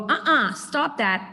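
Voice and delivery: slow, firm "Uh-uh, stop that"; calm but firm correction to the puppy. Shown as a demonstration. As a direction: stop the dog with a slow, steady warning. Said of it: "you go".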